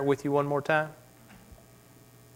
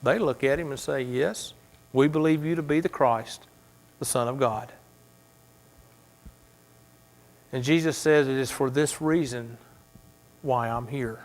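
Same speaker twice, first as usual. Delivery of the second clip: hushed; 5 dB quieter than usual. Acted. Hushed dialogue with Jesus.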